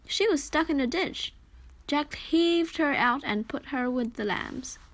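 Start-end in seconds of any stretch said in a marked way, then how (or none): none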